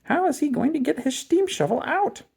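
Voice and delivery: high, prim lady's voice; a fussy old schoolteacher asking; teacher voicing worry, high lady's voice; Miss McGillicuddy. A narrator's reading. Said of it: "said Miss McGillicuddy".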